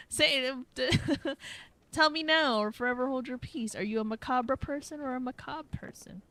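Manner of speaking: loud laughing challenge; playful teasing. Real, not acted. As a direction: tease the viewers, laughing. Teasing the audience loudly.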